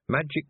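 "Magic" with the last K clicked out repeatedly; "Magic" ending in exaggerated, repeated K sounds; hard final K. A narrator's reading. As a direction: make the end of "Magic" a stuttered k-k-k. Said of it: "Magic with a K".

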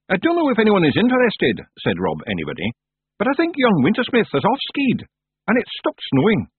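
high, tiny Scottish pictsie; small Scottish fairy man; Scottish accent. Acted. Rob Anybody, Scottish pictsie.

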